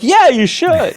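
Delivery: high, loud, emphatic yes; imitated eager agreement. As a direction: re-enact a team jumping to agree. Team eagerly agrees in chorus.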